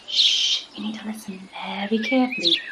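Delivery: put-on whispery hush in a made-up guide accent; hushed warning. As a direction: shush them like a safari guide on the trail. Guide hushes pupils in pretend jungle.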